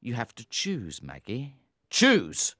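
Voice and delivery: stern anger. A narrator's reading. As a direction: press her hard, growing fierce. Tom's ultimatum builds.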